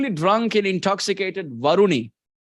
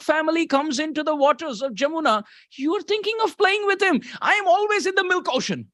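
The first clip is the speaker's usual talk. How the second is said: high-pitched, indignant complaint in character; Balaram; 11 semitones higher than usual. Acted. Balaram complaining to Krishna about being used as a bed, high-pitched protest. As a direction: protest in a much higher, sing-out voice. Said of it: "Balaram is thinking".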